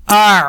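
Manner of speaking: آ pressed out loud from the middle of the throat; loud pharyngeal ع sound; throaty ain sound. Shown as a demonstration. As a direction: push آ from mid-throat with pressure. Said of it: "جیسے".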